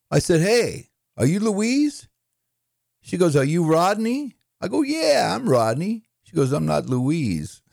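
New York comic pitched high and eager; high-pitched back-and-forth in a comedian's patter; Rodney Dangerfield. Acted. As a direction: act out the blind-date exchange up high. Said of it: "let's do a couple of Rodney".

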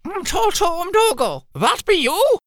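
put-on high, slow old woman's voice; old cracked voice. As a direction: creak it out like an old mother. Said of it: "asked an old cracked voice".

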